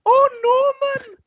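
very high, loud cartoon child cry; mocking squeal of a cartoon kid; Fireman Sam kid. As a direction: shout it out in a piercing high child voice. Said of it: "you sounded like the kid from Fireman Sam".

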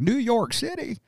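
high, soft mock cowboy cry of New York City; quoting the salsa commercial. Quoting the Pace Picante ad to friends, high soft mock outrage. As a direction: echo the Pace ad's shocked cowboys, pitched high and soft. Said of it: "seen the commercial for, uh, Pace Picante".

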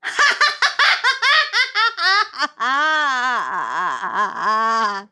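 high cackling witch laugh, loud. Shown as a demonstration. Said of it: "I'd be like this".

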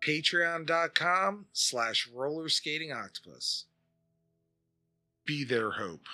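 soft, somber charity ad read; charity commercial voiceover. Acted. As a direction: plead softly over sad music.

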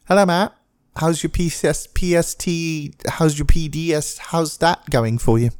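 high, put-on voice of his mom fumbling the acronym; imitating mom. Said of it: "my mom actually could never pronounce P T S D".

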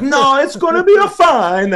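high, loud, drawn-out mock Italian accent. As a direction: brush it off in a hammed-up Italian accent. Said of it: "the Italian accent is approaching".

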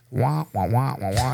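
imitating muffled wah-wah grown-up voices; Snoopy adult voices. Describing hospital voices he couldn't understand, mimicking wah-wah. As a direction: mimic the Peanuts teacher drone. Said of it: "if you ever watch Snoopy".

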